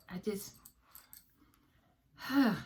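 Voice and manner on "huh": usual speaking voice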